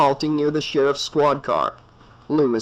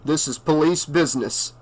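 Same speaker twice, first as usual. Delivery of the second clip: ordinary delivery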